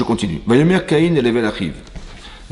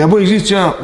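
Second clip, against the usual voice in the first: sudden higher, louder sneer climbing on the last word; mock defiant retort; 9 semitones higher, 4 dB louder than usual. Cain taunting his brother, hammed-up higher challenge. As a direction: toss out the taunt like a challenge before striking. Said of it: "Et il a dit".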